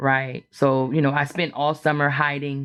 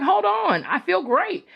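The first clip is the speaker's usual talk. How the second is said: much higher, bright protest that she's fine; mock cheerful insistence. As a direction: insist you feel great, pitched way up. Retold insisting she feels fine.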